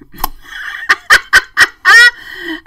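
high, loud burst of real laughter. Real, not acted. After confessing the stolen lemon, bursting into laughter. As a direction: laugh hard at your own story.

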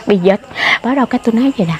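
normal talking voice

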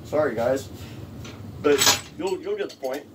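ordinary talk, nothing marked

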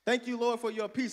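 rolling sermon build; preaching. Acted. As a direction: lift the church with it. Preaching at full force.

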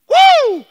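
very high loud preacher's whoop; ecstatic whoop. Real, not acted. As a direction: let out a joyous woo.

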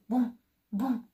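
booming footsteps, still far off; approaching bear steps, moderately loud; distant booms. A narrator's reading. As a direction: thumps getting closer. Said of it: "din ce în ce mai tare".

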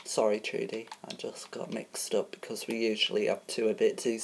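normal talking voice

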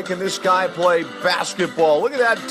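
usual speaking voice

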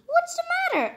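high, concerned asking; George asking. Acted. George asking the squeaking mice, high and concerned.

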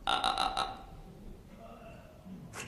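low, slow creaky "ah"; dry vocal-fry croak, pulse by pulse. Shown as a demonstration. Teacher asking the audience to copy this sound, creaky vocal fry. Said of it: "この音出せますか".

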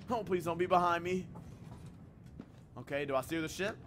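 ordinary talk, nothing marked

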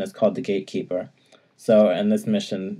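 ordinary delivery

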